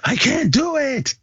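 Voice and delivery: very high, whiny blubbering; squeaky, hammed-up tearful protest; mock crying. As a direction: imitate a man wailing in despair. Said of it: "Al started to cry".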